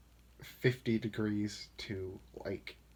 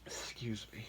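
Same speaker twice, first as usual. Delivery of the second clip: soft breathy whisper; 7 dB quieter than usual. Real, not acted. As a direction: whisper it quietly. Whispered after sneeze.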